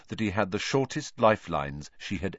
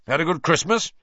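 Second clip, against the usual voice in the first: cheery greeting; 9 semitones higher, 6 dB louder than usual. A narrator's reading. Wood greets Harry cheerfully.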